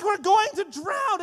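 high, loud cry of alarm; panicked shout. Retold or imitated. Disciples crying out to Jesus, high panicked shout. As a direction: yell it like you're going under.

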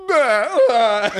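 high, loud bah cries; toddler wail. Retold or imitated. Little kid wailing as he flops, high. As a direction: fake a tantrum flop.

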